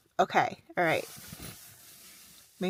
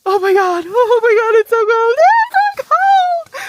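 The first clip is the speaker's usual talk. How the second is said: rising, louder and higher cries of cold; frozen shriek; freezing wail; 11 semitones higher, 13 dB louder than usual. Real, not acted. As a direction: build to a squeal, freezing. Freezing at canyon.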